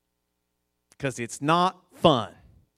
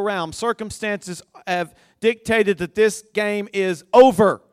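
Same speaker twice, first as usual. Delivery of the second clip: higher, louder, building sermon climax; full-force preaching; 5 semitones higher, 6 dB louder than usual. Acted. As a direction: preach it up to a roaring peak.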